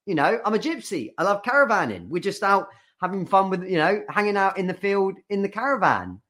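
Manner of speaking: lightly voiced carefree husband. Husband defends the caravan trip, mock carefree. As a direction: play dumb, chirpy husband. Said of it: "he's like".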